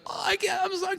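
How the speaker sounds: put-on very high, sweet child's apology; little girl voice. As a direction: squeak it like a flustered little girl. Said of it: "And she go".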